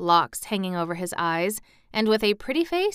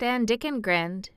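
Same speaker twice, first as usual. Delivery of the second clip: ordinary delivery, nothing marked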